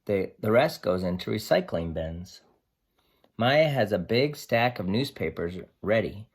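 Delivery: lively picture-book read-aloud; reading to children. Acted. Teacher reading Sam the dog's story to her class, lively and expressive.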